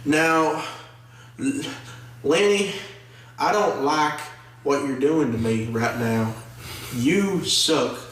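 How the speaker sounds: halting, put-on Southern accent; Tom Holland. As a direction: parody a bad Southern accent in broken phrases. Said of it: "a Southern accent".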